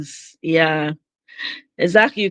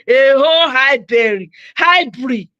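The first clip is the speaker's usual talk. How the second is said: a louder, eager hello to a viewer; excited greeting; 4 semitones higher, 4 dB louder, slower than usual. Real, not acted. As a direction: call out hi, Berry with a burst of delight. Suddenly greeting missed viewer Berry.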